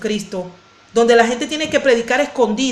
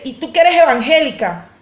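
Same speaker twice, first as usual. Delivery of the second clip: mock accusation, slightly raised; imitated angry accuser; 2 semitones higher, 4 dB louder than usual. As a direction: imitate someone confronting you in the street. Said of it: "Y de repente me dice".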